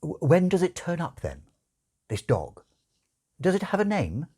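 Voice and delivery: a touch of a fretful angel prying; Aziraphale, fussy angel. Acted. The angel asks about dog.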